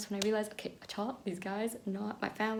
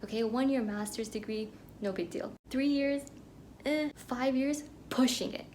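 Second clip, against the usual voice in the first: usual speaking voice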